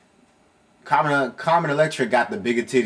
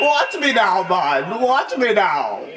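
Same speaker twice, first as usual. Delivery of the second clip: higher mock copy of the taunt; mimicking game voice; 6 semitones higher, slower than usual. Player parroting the fighter's taunt in a higher voice. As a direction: echo the character's boast playfully. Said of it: "mimics game character line".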